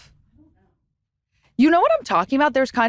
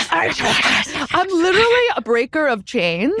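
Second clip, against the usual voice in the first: mock-heroic; 5 semitones higher, slower than usual. Mock-epic boast.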